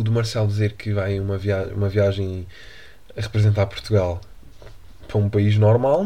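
ordinary talk, nothing marked

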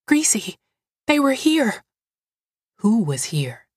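put-on high, urgent child's voice; scared little girl. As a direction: blurt it out like a frightened child. Frightened Mildred tells Greasy.

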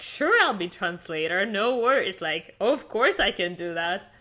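retold cheerful bravado; breezy confidence. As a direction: brush off any doubt and agree eagerly. Retold eager yes despite little English.

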